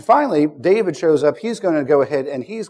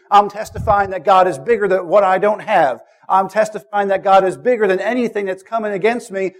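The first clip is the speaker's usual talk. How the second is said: rising testimony, louder with each line; preaching at full force; 3 semitones higher, 4 dB louder than usual. Acted. Preacher testifying to the congregation, growing louder and more fervent. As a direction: build the declaration like a preacher in full cry.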